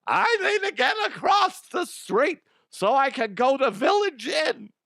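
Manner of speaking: very high, hammed-up old lady. Old lady crossing on her scooter, high mock elderly voice. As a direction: squawk it as a comic old woman. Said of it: "this old lady, and she's like".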